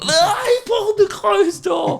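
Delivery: very high, drawn-out jeer; stretched-out high-pitched taunting cry; mocking squeal. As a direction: squeal it like kids gleefully ridiculing someone. Kids erupt mocking the comic.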